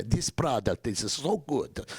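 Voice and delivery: slow, imitated Italian accent. Young Italian researchers praising the product, in an Italian accent. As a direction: put on an Italian accent, drawing the words out. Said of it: "I have to do the accents".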